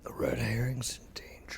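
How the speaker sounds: hushed unvoiced whisper. Real, not acted. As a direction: whisper it under the table talk. Whispered table asides.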